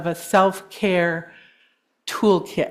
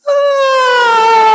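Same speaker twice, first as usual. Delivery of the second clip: long, high, loud ah released on the exhale; open ah exhale; 10 dB louder than usual. Shown as a demonstration. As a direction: sing the breath out on an open ah. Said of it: "Let's do that again".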